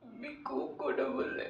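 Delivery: soft, tearful admission of guilt; subdued confession. Acted. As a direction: confess quietly, weighed down by remorse. Tearful confession, first take subdued.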